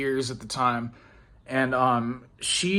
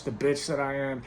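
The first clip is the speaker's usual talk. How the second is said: ordinary delivery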